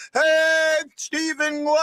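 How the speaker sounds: high, drawn-out holler of a name; called-out greeting. Real, not acted. Host greeting a viewer by name, called out high. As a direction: sing out the greeting, high and long.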